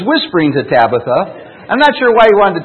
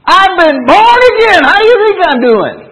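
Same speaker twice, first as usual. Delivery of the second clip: imitating Joe, much higher and louder; excited old man; 9 dB louder than usual. Old friend Joe answers the pastor's phone call, excited. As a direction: burst out joyfully like an old man reborn. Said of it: "He says".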